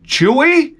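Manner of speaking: high calling the dog; high teasing dog call; sing-song call. Retold or imitated. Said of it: "I said".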